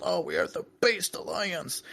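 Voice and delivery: high, soft put-on voice; mock based propagandist. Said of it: "wearing the shirt of based and red pill".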